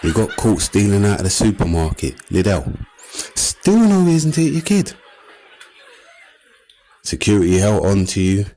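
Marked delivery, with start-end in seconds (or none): 3.4-4.9 exasperated. Acted. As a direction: push the point harder. Exasperated repetition of point.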